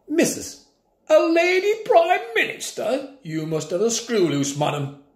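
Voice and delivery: put-on high, outraged old-timer; appalled at the very idea; scandalised old man. A narrator's reading. Grandpa scoffing at the teacher, high and scandalised.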